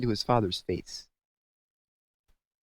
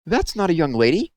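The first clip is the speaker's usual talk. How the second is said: high, loud excited shriek; 8 semitones higher, 6 dB louder than usual. A narrator's reading. Owlet spotting who he thinks is Santa, shrieking. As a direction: squeal it out in delight. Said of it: "shrieked Plop".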